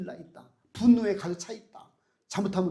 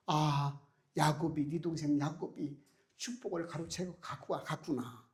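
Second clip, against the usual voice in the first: dismayed realization; 4 semitones lower, 7 dB quieter than usual. Acted. Isaac realizes Jacob's deceit.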